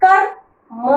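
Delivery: कर्म said as kar-m, the र sounding before म; pronunciation demo. Shown as a demonstration. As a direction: model कर्म slowly so the half र is heard. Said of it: "इसको बोलते देखो".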